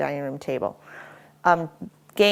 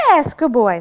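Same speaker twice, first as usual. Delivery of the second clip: excited praise; 5 semitones higher, 10 dB louder than usual. Real, not acted. Praising dog's success.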